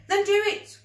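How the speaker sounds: high, loud urgent command; a loud frantic demand; cried. Acted. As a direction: shout it, desperate. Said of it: "cries Billy".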